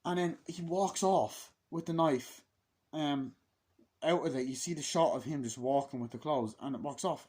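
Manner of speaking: usual speaking voice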